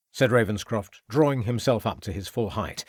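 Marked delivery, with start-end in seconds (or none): none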